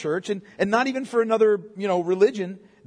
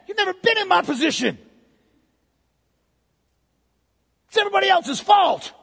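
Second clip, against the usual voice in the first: whiny excuse-maker; 2 semitones higher than usual. Retold or imitated. Preacher voicing the sick man blaming everyone, building to a whiny shout. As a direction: whine your excuses until you yell. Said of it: "No, he's like".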